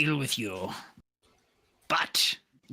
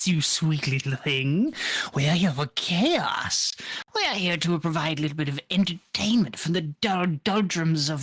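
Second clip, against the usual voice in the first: seductive jester voice; 3 semitones higher than usual. Acted. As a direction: purr it out like a taunting harlequin. GM voices the Harlequin.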